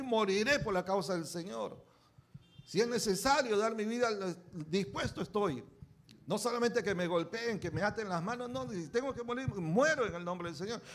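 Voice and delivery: loud, high, fervent preaching of Paul's vow; preaching at full force. Acted. Preacher to congregation, voicing Paul, fervent shouted sermon. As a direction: thunder Paul's resolve to the church.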